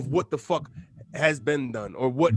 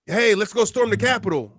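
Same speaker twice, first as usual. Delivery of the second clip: higher, louder mock rallying cry; mock rioter call; 6 semitones higher, 5 dB louder than usual. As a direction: ham up a rioter egging others on.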